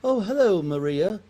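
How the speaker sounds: high, put-on English charmer; English accent. Englishman flirting on the Jersey beach, put-on English accent. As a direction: chat up a woman in a posh English accent. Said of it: "I'm impressed you can even do any".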